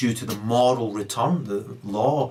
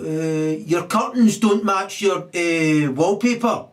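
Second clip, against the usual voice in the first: mock sneering jibe; 6 semitones higher, 4 dB louder, much slower than usual. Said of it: "the unitard, right, says to you".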